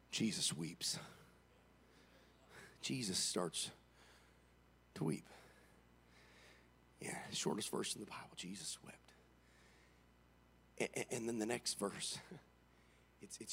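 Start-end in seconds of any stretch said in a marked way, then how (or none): 0.1-9.0 low, very soft hush; hushed preaching. Acted. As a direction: drop it to a near whisper.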